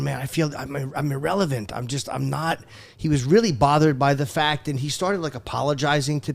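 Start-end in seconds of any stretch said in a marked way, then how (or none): 0.0-2.6 a soft, deflated Patrice quote; quiet regretful quote. Retold or imitated. Patrice quietly admits he feels irrelevant, deflated and soft. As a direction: mutter it like a man feeling past it. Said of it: "I remember him going".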